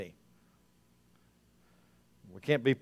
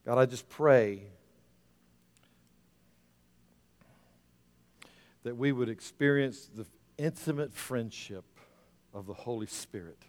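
leading prayer. Real, not acted. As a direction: pray in measured phrases, leaving space between them.

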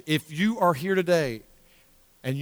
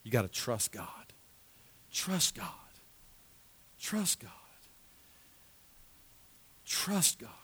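soft, falling to near-whispered repeats; hushed emphasis; 6 semitones lower, 12 dB quieter than usual. Real, not acted. Preacher underlining trust in God for the congregation, hushed emphasis. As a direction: underline TRUST quietly, with pauses. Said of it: "let me just underline that word".

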